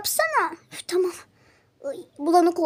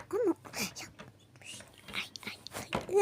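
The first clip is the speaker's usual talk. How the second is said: whispering; 2 semitones higher, 13 dB quieter than usual. Real, not acted. Caught while hiding.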